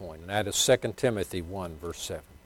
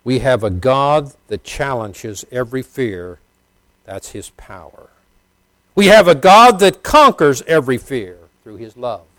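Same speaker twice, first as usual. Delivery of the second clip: preaching; 2 semitones higher, 10 dB louder than usual. Acted. Preaching at full force.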